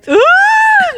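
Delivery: the voice cracks up into a loud squeal; failed high squeak. Shown as a demonstration. Trying a super feminine voice, a loud high squeak. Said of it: "no me sale".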